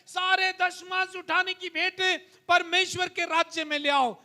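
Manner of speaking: rising pulpit fervour; fervent preaching. Acted. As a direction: preach it to the church with conviction. Urging church to read aloud.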